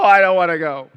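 loud whine falling to a pout; sulky whine. Retold or imitated. Churchgoer resisting accountability group, falling sulky whine. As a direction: sulk it out, settling down. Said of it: "how most of us feel".

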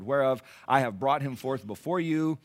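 ordinary talk

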